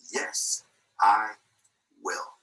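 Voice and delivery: each word set apart: "Yes... I... will"; deliberate motto. Real, not acted. Speaker handing young adults a motto, deliberate and spaced out. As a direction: land it word by word, with firm pauses. Closing motto given to the audience.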